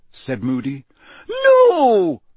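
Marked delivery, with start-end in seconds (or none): 1.3-2.2 very high, loud, drawn-out cry; horrified cry. A narrator's reading. McGonagall running down the stairs, crying out. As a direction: cry out in alarm, stretching the word. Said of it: "cried Professor McGonagall".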